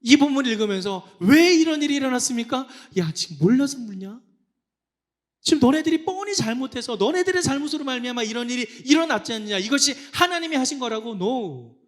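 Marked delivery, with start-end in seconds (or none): 1.2-2.7 high, loud protest; confronting God. Acted. Preacher voicing Israel's complaint to God, loud demand. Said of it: "하나님께 따집니다". 3.0-11.1 loud, high rebuke; indignant scolding. Acted. God answering the complaining Israelites, indignant scolding.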